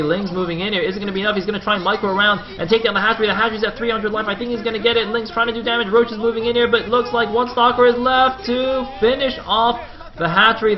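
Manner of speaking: high, loud, urgent running commentary; breathless-paced esports caster call; excited play-by-play, building. Real, not acted. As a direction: keep the tension climbing to the finish. Live call of hatchery race.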